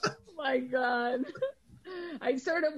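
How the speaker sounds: can barely get it out; tearful laughter; laughing to tears. Real, not acted. Overcome after the joke.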